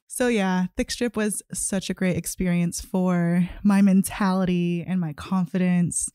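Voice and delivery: normal talking voice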